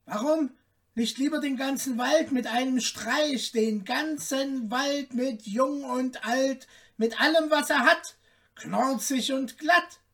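high, cocky tailor voice; little man piping a grand boast; boastful little tailor. A narrator's reading. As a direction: pile up the brag in a high voice. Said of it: "fragte das Schneiderlein".

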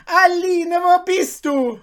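high, loud, slow call for the dog; eunuch calling. A narrator's reading. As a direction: sing out for the lost lapdog. Eunuch calls the lost dog.

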